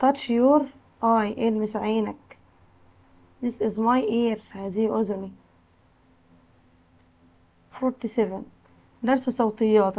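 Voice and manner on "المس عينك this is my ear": ordinary talk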